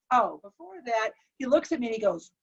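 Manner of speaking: ordinary delivery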